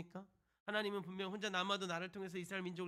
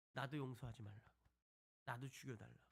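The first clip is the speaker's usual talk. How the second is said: low, softened plea with falling endings; retold solemn prayer; 6 semitones lower, 7 dB quieter than usual. Moses pleading with God for his people, retold low and solemn. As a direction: drop into a subdued, prayerful offer of oneself. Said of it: "백성들을 위해서 또 기도하기 시작을 하죠".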